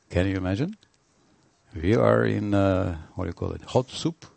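ordinary talk

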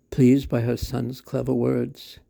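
usual speaking voice

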